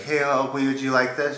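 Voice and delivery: a hint of a sulky baby voice. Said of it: "Hope's got something that I call baby voice".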